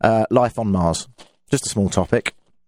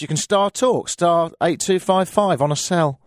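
ordinary delivery

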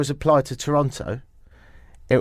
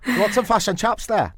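higher-pitched Kiwi attempt that comes out Scottish; attempted New Zealand accent; 7 semitones higher than usual. Shown as a demonstration. Host demonstrating Kiwi vowels to co-host, mock New Zealand accent. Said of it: "mine just sounded Scottish".

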